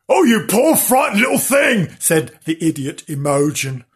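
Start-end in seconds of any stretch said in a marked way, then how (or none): 0.1-2.0 high, shouted fussing over a puppy; loud gushing. Acted. Idiot sister pitying the puppy, loud gushing. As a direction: gush it loudly, pitched right up.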